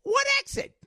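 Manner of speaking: high, squeaky What exit; imitating Jersey lady. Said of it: "She said".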